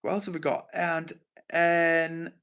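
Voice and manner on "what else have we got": normal talking voice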